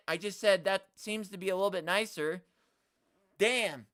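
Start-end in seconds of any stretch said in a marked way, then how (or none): none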